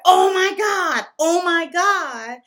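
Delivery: high and loud retold shock; stunned gasp. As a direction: cry out in disbelief. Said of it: "and then I'm just like".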